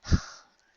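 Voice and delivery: breathy tired sigh; weary sigh. A narrator's reading. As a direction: let out a long sigh.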